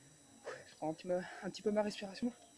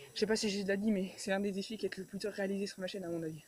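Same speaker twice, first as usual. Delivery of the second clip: ordinary delivery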